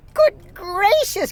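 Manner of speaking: high, slow shocked gasp; startled by the green glunk. A narrator's reading. Sister startled by the glunk, high slow gasp. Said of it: "gasped my sister".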